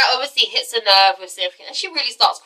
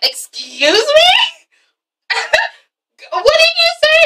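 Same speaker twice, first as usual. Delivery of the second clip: offended shock. Retold or imitated. Cynthia reacting to her daughter, offended shock. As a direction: pitch it way up in disbelief. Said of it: "And Cynthia's like".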